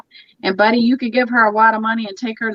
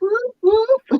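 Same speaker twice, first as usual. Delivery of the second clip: whoop; 11 semitones higher than usual. Retold or imitated. Cheering grandma at the slots.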